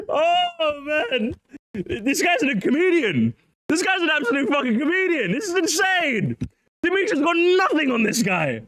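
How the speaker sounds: high, laughing, wheezing through the words; can't hold it together, real laughter; laughing disbelief. Real, not acted. Streamer cracking up at clip.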